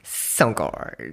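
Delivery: low creaky LA vocal fry. Retold or imitated. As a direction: drop into a lazy vocal fry. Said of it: "L A Vocal Fry".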